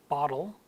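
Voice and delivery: bottle with an open ah and D-like T; American open vowel. Shown as a demonstration. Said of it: "so an open ah".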